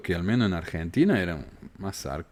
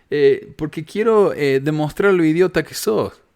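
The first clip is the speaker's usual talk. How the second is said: louder, higher, mocking put-on voice; mock rude invitation; 7 semitones higher, 6 dB louder than usual. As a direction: sneer it as the rude way to invite a guest. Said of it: "No le voy a decir". (invitación grosera en tono burlón, más fuerte)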